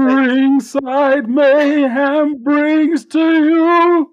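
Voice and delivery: loud, high, very slow announcer call; grand entrance announcement. Acted. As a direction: stretch it like a ring announcer.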